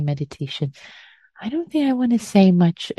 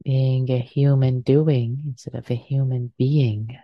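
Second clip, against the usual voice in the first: guided meditation. Acted. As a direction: ease them gently into stillness.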